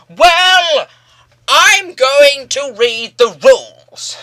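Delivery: hammed-up examiner reciting rules; mocking old hag examiner reading rules. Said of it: "this old hag".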